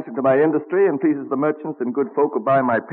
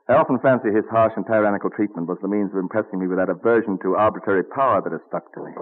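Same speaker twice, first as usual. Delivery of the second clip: ordinary delivery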